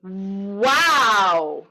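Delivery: "wow" stretched from a closed ooh into a loud open ah; "wow" as a long rising-then-falling siren; small to big. Shown as a demonstration. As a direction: swell slowly through "wow". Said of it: "So it's small to big".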